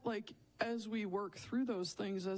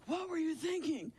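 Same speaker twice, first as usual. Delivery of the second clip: higher, exasperated What were you thinking; exasperated scolding; 10 semitones higher than usual. Retold or imitated. A fed-up boss scolding a coworker, higher and exasperated. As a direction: let it fly like a fed-up boss. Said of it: "stop myself from being like".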